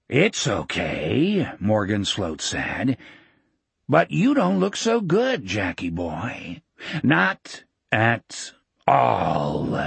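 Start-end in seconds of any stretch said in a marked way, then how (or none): 0.1-1.5 low, slow, sneering villain; menacing villain. Acted. Villain gloating. 3.9-9.8 menacing villain. Acted. Villain taunting Jack.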